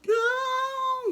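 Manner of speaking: high, loud desperate yell; mother in labor yelling back at the nurse; shouted no. Retold or imitated. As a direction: yell the answer out in pain. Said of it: "Gritando assim".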